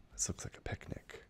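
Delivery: hushed secret whisper; whispering about a picnic. Pretend. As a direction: breathe it like sharing a secret. Sharing a secret picnic find.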